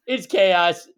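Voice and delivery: loud, high playful echo; excited. Acted. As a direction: throw the line back with gusto. Echoing co-host's joke loudly.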